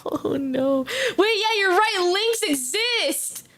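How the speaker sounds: normal talking voice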